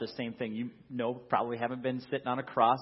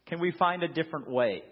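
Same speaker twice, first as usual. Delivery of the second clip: louder, earnest plea; Jesus pleading in the garden, raised voice; pleading with God; 4 semitones higher, 5 dB louder than usual. Acted. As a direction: beg for another way. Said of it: "where Jesus is like".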